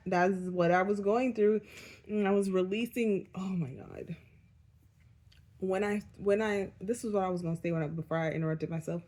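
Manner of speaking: ordinary talk, nothing marked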